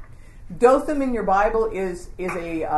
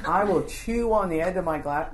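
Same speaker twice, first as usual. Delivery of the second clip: normal talking voice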